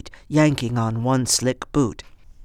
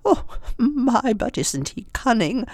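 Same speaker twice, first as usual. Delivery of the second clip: high, lightly voiced old woman; 10 semitones higher than usual. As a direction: chirp it like an eccentric old lady.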